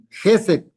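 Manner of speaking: "Gesed" punched on Ge, then falling; stress on first syllable. Shown as a demonstration. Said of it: "acento allá".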